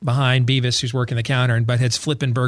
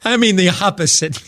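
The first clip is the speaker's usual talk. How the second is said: laughing; 6 semitones higher than usual. Real, not acted. Laughing at appalling gif.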